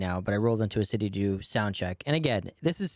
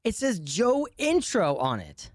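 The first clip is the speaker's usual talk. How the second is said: high-pitched mock yell, drawn out at the end; high, exasperated outburst; retold exasperated scream; 9 semitones higher than usual. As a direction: scream it in disbelief without much added volume. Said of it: "And then I'm just screaming".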